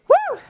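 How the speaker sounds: high "woo" on an exhale; effort woo. Real, not acted. Instructor puffing through her workout, high effort woo. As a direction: blow out a high, airy woo.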